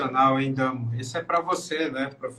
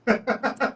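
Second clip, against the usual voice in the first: burst of laughter; 8 semitones higher than usual. Real, not acted. Laughs at own joke.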